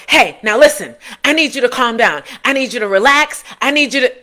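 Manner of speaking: loud, high, tense commands to calm down; pushy stressed parent telling a child to calm down; stressed commanding. Retold or imitated. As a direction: bark at the child to relax, half laughing.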